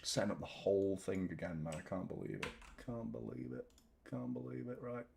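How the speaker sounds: soft repeated muttering to himself; muttered disbelief. Real, not acted. Streamer fixing his broken setup, quiet disbelieving mutter. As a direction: mutter it under your breath, deflated.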